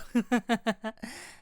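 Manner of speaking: a gleeful little laugh; giddy giggle. Acted. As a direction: giggle with glee. Delighted to look.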